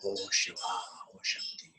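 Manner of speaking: prayerful invocation. Acted. Teacher leading the group in closing prayer, devotional. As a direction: call on Shiva and Shakti in prayer.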